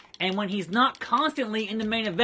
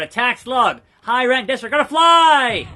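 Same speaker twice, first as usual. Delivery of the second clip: louder and higher up to a yell; building shout; 5 semitones higher, 8 dB louder than usual. Pretend. Wrestler launching his move, building shout. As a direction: build to a long shouted last word.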